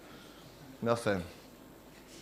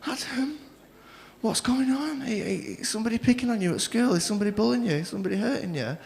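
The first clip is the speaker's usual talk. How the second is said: worried mum voice; 7 semitones higher, 5 dB quieter than usual. Retold or imitated. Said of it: "She was like".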